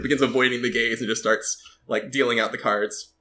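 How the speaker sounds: usual speaking voice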